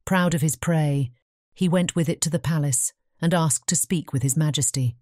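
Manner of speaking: ordinary delivery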